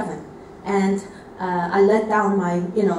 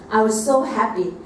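ordinary delivery

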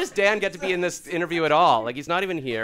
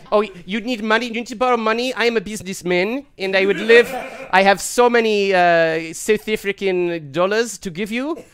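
loud South African businessman voice; 4 semitones higher, 7 dB louder than usual. Acted. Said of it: "This is his audition".

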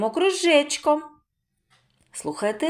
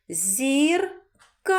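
"Зірка" high, split, with КА held long; stress on second syllable; 4 semitones higher than usual. Shown as a demonstration. Teacher asks where stress falls, long КА in "Зірка". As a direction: lengthen КА after a pause in "Зірка". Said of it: "На який склад падає наголос?".

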